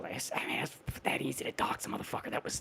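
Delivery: fast, hushed whisper; quick awed whisper. Real, not acted. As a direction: whisper it like a shocked secret. Awed aside about easy doxxing.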